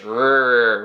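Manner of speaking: drawn-out American "Rrr", loud; long hard American R. Shown as a demonstration. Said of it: "which are hard R's in English".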